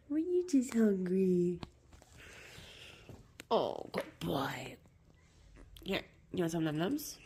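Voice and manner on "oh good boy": soft breathy whisper, slow. Real, not acted. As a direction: lean in and whisper the praise to the dog. Whispering softly to the dog.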